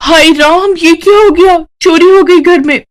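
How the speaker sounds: high, loud fake cry of alarm; shouting in alarm. Indu fakes alarm over the theft to the household, shouting. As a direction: raise the house with a staged panic. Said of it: "जोर-जोर से चिल्लाते हुए कहती है".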